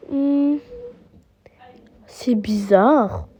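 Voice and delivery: suspicious hushed whisper; whispered suspicion. Acted. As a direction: whisper it as if something is off. Suspicious mum checking.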